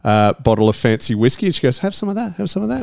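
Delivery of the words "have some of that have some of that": higher, softer, coaxing wife imitation; mock wife voice. Husband imitates his wife offering him whiskey, high and soft. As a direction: play the wife, lifted and hushed, tempting him.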